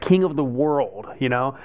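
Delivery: ordinary talk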